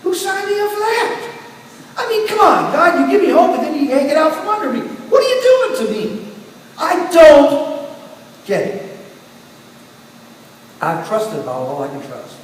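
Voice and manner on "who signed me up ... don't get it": much higher, incredulous protest; exasperated at God. Retold or imitated. Believer protesting to God after hope is yanked away, high incredulous exasperation. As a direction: throw the hurt question up at God, pitched high.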